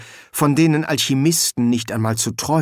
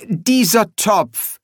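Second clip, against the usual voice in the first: slow, higher-pitched pronouncement; deliberate bursar; 8 semitones higher, 3 dB louder, much slower than usual. Acted. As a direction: draw the words out in a high voice. Bursar examines and explains the pot.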